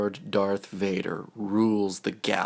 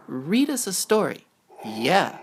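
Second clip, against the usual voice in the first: much higher, fast eager plea; begging child; 10 semitones higher, 4 dB louder than usual. Acted. Twins begging their father for a story, eager. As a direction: beg like a kid stalling bed.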